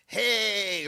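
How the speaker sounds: goofy high, loud SpongeBob-like greeting; Adam Sandler. Retold or imitated. Comedian imitating a star greeting his classmate, SpongeBob-like. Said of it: "You make him sound like fucking SpongeBob".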